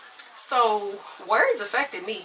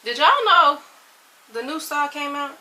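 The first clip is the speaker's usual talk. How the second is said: excited; 5 semitones higher, 6 dB louder than usual. Real, not acted. Hyping viewers about new film.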